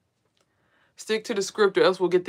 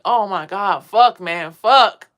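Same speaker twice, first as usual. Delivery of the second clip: louder, rising to a yelled fuck; a stranger swearing in alarm; startled cursing; 9 dB louder, much slower than usual. Retold or imitated. The woman in the house cursing, startled and loud. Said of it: "all I heard was like".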